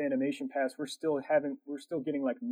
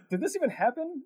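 higher, incredulous question; doubting his own eyes; retold incredulity; disbelief; 12 semitones higher than usual. Driver drove off with Gatorades.

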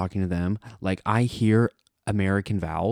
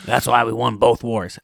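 mock American bravado; 3 semitones higher, 4 dB louder than usual. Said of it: "It was like".

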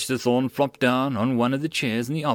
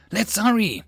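urgent; 10 semitones higher, 4 dB louder than usual. A narrator's reading. Urgent call to go.

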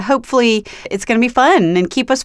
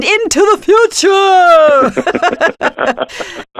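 mock announcer; 8 semitones higher than usual. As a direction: announce it grandly, playfully stretching the ending. Host hams up the guest's title.

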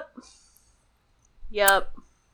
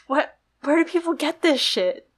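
incredulous; 5 semitones higher than usual. Retold or imitated. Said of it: "And I'm just like".

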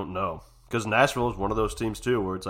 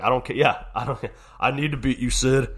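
higher, louder intense rival voice; Ovechkin taunt; 5 semitones higher, 7 dB louder than usual. Retold or imitated. Ovechkin vowing to beat Crosby, intense. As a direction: fire it at Sid, fierce. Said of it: "Even Ovechkin was like".

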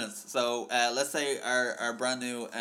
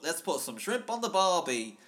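put-on higher, slow, botched Australian accent; uncertain mock accent; 4 semitones higher than usual. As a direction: attempt Australian and miss slightly. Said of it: "I don't know what accent I'm doing now".

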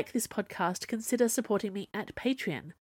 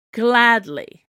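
loud, higher, biting comeback; snapped retort; 5 semitones higher, 10 dB louder than usual. A narrator's reading. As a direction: throw the word straight back at him. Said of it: "Cyrus snapped back".

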